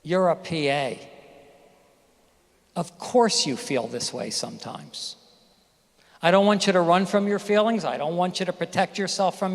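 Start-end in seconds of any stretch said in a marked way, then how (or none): 0.0-5.2 gentle reassurance. Retold or imitated. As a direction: say it like a kind inner voice. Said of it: "hear the voice that says".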